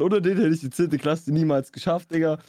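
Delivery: normal talking voice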